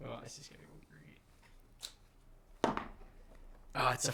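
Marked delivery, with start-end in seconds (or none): 0.1-2.8 unvoiced sarcastic aside; sarcastic whisper. Real, not acted.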